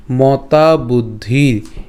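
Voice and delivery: মতা বুদ্ধির very slow, with long vowels; slow model of মতা বুদ্ধির for learners; pronunciation demo. Shown as a demonstration. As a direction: stretch the ending of বুদ্ধির into a bare r. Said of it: "অর্থাৎ বুদ্ধির উচ্চারণ করছি".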